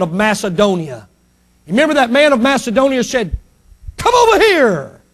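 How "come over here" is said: high, far-carrying call. Retold or imitated. Man of Macedonia to Paul, high call. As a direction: summon someone from afar, pitched high.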